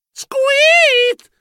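very high, drawn-out squeak; startled mouse squeal. A narrator's reading. Narrator voicing a mouse hero's surprise, shrill squeal.

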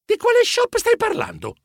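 high-pitched baffled question; confused. A narrator's reading. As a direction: ask in bewilderment, pitch jumping up. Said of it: "sempre più confuso". (domanda confusa, voce acuta)

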